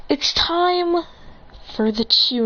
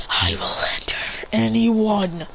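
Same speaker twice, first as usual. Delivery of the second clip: slow, whispered vow; drawn-out hushed menace; menacing whisper; slower than usual. Acted. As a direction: whisper a threat of vengeance. Vengeful vow with wolf eyes.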